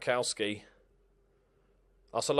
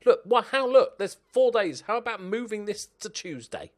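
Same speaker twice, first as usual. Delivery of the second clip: outraged; 10 semitones higher, 8 dB louder than usual. Real, not acted. Outraged at fixture scheduling.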